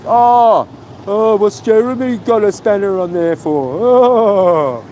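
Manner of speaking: a mildly put-on sneering voice for the critic; mocking whiny critic. Streamer mocks a critic's question in a mildly whiny voice. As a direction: parody the hater's question in a lightly whiny tone.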